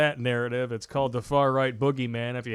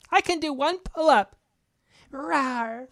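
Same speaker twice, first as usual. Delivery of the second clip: lampoon a weak soldier acting tough; mock tough female soldier; 14 semitones higher, slower than usual. Host mocks a female Ranger by boasting in a high falsetto.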